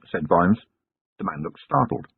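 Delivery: ordinary delivery, nothing marked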